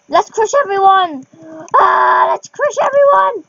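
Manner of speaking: gleeful pretend rampage yell; playful war cry. Playful war cry while riding.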